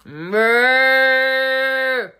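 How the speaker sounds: long, loud, high moo; imitated cow moo. Guest mimicking the hungry baby cows, long loud moo. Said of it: "you hear them go".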